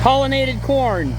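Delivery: loud, high call over engine noise. Real, not acted. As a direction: shout it over the machinery.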